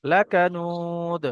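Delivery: Quran recitation. Shown as a demonstration. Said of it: "teacher recites the verse".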